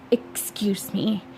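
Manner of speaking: soft unvoiced excuse; mom's hushed excuse leaving the table; whispered. A narrator's reading. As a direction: slip away with a whisper. Mother leaves quietly.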